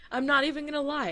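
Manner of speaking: usual speaking voice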